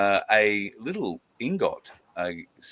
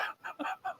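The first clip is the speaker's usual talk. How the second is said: 1 soft chuckle; 13 dB quieter than usual. Real, not acted. Laugh after joke.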